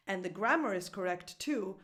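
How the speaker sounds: ordinary talk, nothing marked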